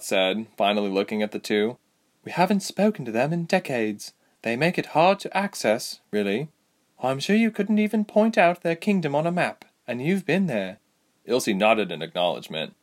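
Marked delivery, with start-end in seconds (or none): 2.2-10.8 higher-pitched, frank woman fighter; Lieselot. Acted. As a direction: answer lightly in a raised character voice. Voices Lieselot's reply.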